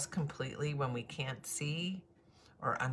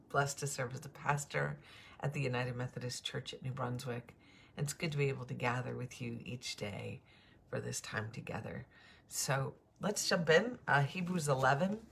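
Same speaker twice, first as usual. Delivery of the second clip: normal talking voice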